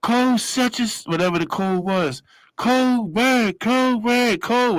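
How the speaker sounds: high, urgent call-out; guard calling a code. Retold or imitated. Guard radioing an emergency code, high and urgent. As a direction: shout the code up the tier. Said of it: "She was like".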